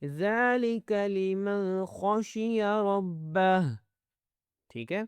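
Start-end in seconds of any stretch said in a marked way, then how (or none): 0.1-3.6 slow, drawn-out tajweed chant; measured teaching recitation, slightly raised pitch; Quran recitation. Shown as a demonstration. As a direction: recite with long held vowels.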